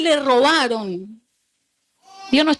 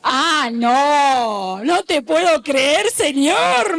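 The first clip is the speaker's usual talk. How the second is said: disbelieving protest, half-laughing; mock the sister's stunned whine at the Lord; imitating the sister; much slower than usual. Pastor retelling the sister arguing with God, stunned protest. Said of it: "me contaba ella".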